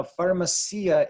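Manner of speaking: "pharmacia" with I and A split, far-ma-SEE-a; mispronounced word. Shown as a demonstration. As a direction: wrongly stress "SEE" in "pharmacia". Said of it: "is not correct".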